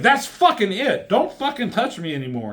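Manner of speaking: high-pitched mock outrage; hammed-up indignant telling-off, easing off at the end; fed-up anger. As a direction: act out someone who has finally snapped. Retold fed-up woman slapping him.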